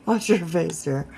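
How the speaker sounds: ordinary talk, nothing marked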